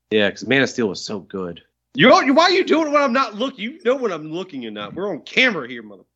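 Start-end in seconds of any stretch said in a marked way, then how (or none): 1.9-4.9 loud, high mock outrage; indignant outburst. Real, not acted. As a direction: call him out, louder. Catches friend flipping bird.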